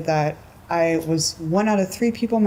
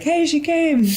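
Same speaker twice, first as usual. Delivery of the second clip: much higher and louder, hammed-up leering joke; mocking customer; 11 semitones higher, 5 dB louder, slower than usual. Customers greeting the pizza delivery driver, higher mocking crude joke. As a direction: crack the crude joke at her arrival.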